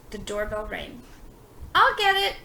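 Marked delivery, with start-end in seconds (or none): 1.7-2.4 higher, loud call; shouted. A narrator's reading. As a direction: call out while rushing off. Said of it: "Donald shouted".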